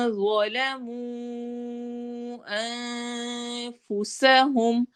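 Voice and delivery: slow soft recitation of ظلموا انفسهم; Quran recitation. Shown as a demonstration. Tajweed teacher reciting for students, slow and soft. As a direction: draw it out gently, heavy ظ. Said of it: "واو لین ہو رہی ہے".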